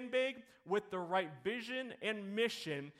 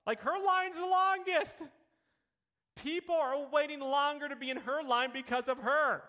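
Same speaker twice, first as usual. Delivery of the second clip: excited; 8 semitones higher, 6 dB louder than usual. Real, not acted. Excited punchline of toll story.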